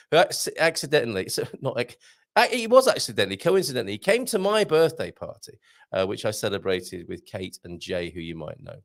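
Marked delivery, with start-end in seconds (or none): none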